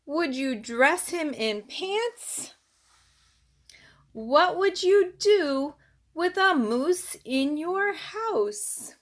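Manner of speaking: lively, singsong picture-book read-aloud; reading to children. Acted. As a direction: read it to a little one, bouncing the rhymes.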